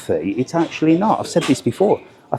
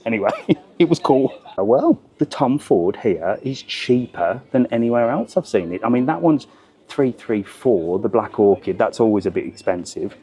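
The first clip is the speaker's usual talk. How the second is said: ordinary talk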